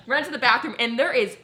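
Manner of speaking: usual speaking voice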